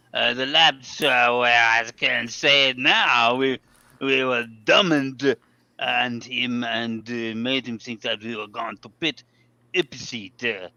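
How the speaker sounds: slow, hammed-up French accent reading a driver's quote. Reading the team quote aloud to co-hosts in a French accent. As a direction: put on your best French accent and take it slow. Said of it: "read it in your best French accent".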